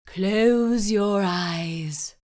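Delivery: very slow, hushed calming voice; dreamy, drawn-out therapist; soothing therapist. A narrator's reading. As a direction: lull her like a relaxation coach. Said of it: "she said".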